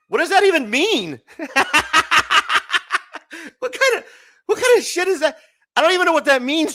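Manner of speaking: loud, high, incredulous outburst; indignant shout. Real, not acted. Yelling back at the chatbot after its insult. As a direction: yell at the screen in disbelief.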